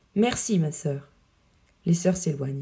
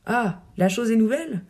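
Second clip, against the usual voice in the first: usual speaking voice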